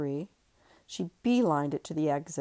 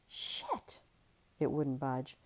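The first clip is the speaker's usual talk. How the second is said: usual speaking voice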